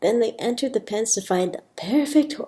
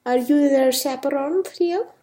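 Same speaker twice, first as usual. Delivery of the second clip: higher, put-on Spanish accent; attempted Spanish accent, rising at the end; 6 semitones higher than usual. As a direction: ask politely in a Spanish accent. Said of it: "Sophia's got a bit of a Spanish accent".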